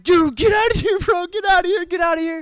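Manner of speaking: very high, loud hammed-up shouting; excited shout. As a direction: yell it in disbelief at the knockout. Filming guy shouting excitedly.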